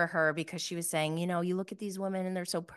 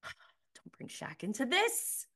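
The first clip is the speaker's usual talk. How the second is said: mock warning; 5 semitones higher, 5 dB quieter, slower than usual. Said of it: "I'm like".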